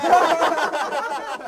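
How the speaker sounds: very high, drawn-out laugh; amused high cackle; hearty laugh. Acted. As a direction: burst into high-pitched laughter. Laughs at the washerman boast.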